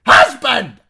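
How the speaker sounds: high, full-voiced call; shout. A narrator's reading. Wife summoning her husband from outside, loud high shout. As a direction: yell for him at the top of your voice. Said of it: "but at her shout".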